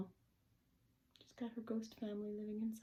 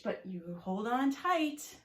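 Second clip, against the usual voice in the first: louder, drawn-out playful warning; warning little witch; 4 semitones higher, 8 dB louder, slower than usual. Acted. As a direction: stretch it out as a witch's caution. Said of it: "giggles the little witch".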